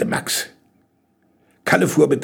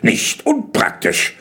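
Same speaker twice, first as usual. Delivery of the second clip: slow, high-pitched praise; drawn-out approval; approving; 12 semitones higher, slower than usual. A narrator's reading. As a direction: praise it, weighing each syllable. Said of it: "lobte Kalle".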